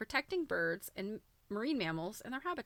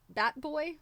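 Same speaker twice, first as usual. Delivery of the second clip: laughing; 7 semitones higher, 4 dB louder than usual. Real, not acted. Amused at bat-wing quilt.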